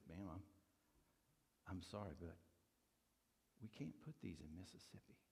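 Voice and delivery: soft, low, holding back tears; softly choked apology. Retold or imitated. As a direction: apologize through tears, quietly. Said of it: "You can't believe how I'm crying".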